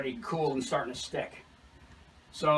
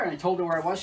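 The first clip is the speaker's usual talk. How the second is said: usual speaking voice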